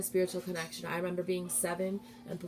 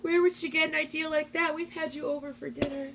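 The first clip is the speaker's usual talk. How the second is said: tearful quote; 9 semitones higher than usual. Real, not acted. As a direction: voice breaking into real tears while quoting the stepmother. Quoting abusive stepmother while crying.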